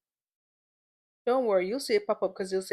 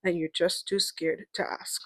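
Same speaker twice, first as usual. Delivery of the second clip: hyped show host; 10 dB louder than usual. Acted. Host answering listener questions in her segment, hyped host voice. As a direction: keep the segment voice big and playful throughout.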